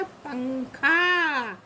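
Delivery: पंखा slowly as pang, khaa; drawn-out syllables. Shown as a demonstration. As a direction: hold the final aa of पंखा. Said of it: "खा में आ की मात्रा".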